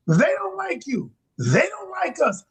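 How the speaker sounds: high-pitched imitation of her complaint; high, repetitive mock protest; insistent retold complaint. Quoting her repeated complaint.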